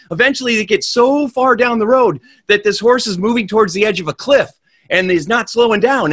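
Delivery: storyteller heightening the race to the cliff; breathless suspense. A narrator's reading. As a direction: build the danger toward the cliff. Horse heading for cliff.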